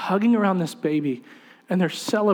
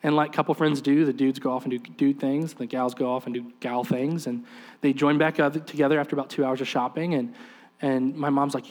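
ordinary talk, nothing marked

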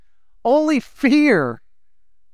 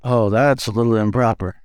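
mock prim; 11 semitones lower than usual. Mock prudish objection.

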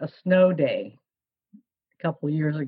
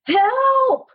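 very high, loud cry for help; hammed-up piercing shout; kid's yell; 5 dB louder than usual. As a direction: imitate a child yelling out. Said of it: "all of a sudden he yells out".

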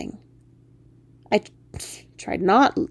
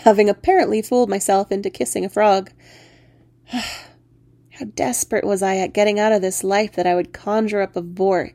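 ordinary delivery

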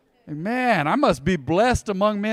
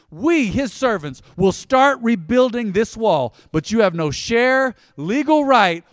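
louder, slow, rising preached declaration; defiant declaration; 5 semitones higher, 6 dB louder, slower than usual. Acted. Nehemiah answering his opponents, slow loud defiance. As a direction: proclaim it like a builder standing firm.

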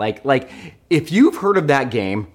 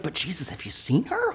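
softer, conspiratorial; sly aside; 4 semitones higher, 9 dB quieter than usual. Retold or imitated. Churchgoer cheekily objecting to Jesus, hushed. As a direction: drop it as a cheeky side remark.

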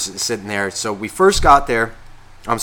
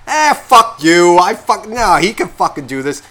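loud, higher, drawn-out "eh, fuck you"; mock rowdy retort; 6 semitones higher, 6 dB louder than usual. Buffer brushing off the warning, loud mock retort. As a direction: shove it back like a drunk buddy.

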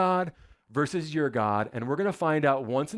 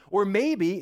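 mocking; 6 semitones higher, 4 dB louder than usual. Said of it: "Elijah began mocking them".

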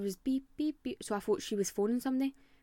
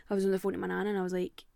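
ordinary talk

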